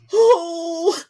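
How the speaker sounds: high, loud, drawn-out swoon of awe; hammed-up, high-pitched gasp of delight; awestruck fan. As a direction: re-enact a fan overwhelmed by the reveal. Acts out reaction to seeing Keaton.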